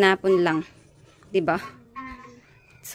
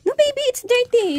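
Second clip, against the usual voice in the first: parental warning; 13 semitones higher than usual. Real, not acted. Mother stopping baby from a dirty item, high-pitched warning.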